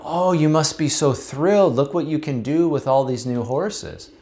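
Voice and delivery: high, gushing delight; thrilled neighbor. Acted. Neighbor congratulates the farmer on the wild horses, gushing high.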